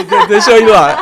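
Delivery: a laughing dash back to the canteen. Retold or imitated. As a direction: laugh through it as you set off. Laughing, going back for food.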